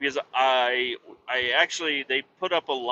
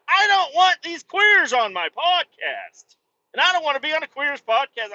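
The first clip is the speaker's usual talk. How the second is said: mock gruff retort; 13 semitones higher, 5 dB louder than usual. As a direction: ham up a cartoonish macho reply. Mocking his own imagined reply.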